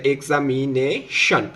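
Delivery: "এক্সামিনেশন" slowly in parts, এক্সা, মি, নে, শন; syllable by syllable. Shown as a demonstration. Teacher putting examination together from its parts, syllable by syllable. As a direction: separate the vowels of "এক্সামিনেশন". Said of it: "আমরা হচ্ছে vowel পর্যন্ত ভাঙবো".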